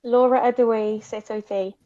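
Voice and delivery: Welsh greeting said as a demo; speaking Welsh. Shown as a demonstration. Teaching the guest a Welsh greeting, clear demo. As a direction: model the Welsh "Setote" clearly for a learner. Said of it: "Okay, you ready?".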